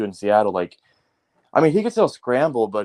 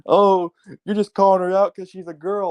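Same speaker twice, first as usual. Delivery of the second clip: mock Swiftie fan; 7 semitones higher, 4 dB louder than usual. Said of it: "a lot of these Swifties are freaking".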